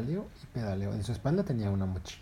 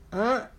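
sleepy heron croak; 12 semitones higher, 6 dB louder than usual. Acted. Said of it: "un poco ronca".

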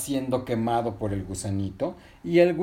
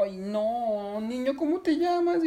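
high-pitched voice of concern; distressed little girl voice; worried girl; 10 semitones higher, slower than usual. Acted. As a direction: ask anxiously, pitched well up. Girl worried by the crying boy.

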